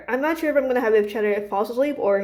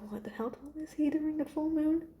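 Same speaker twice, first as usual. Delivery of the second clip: hushed, higher muttering to oneself; whispered aside; 7 semitones higher, 10 dB quieter than usual. Real, not acted. Muttered aside while recalling a detail.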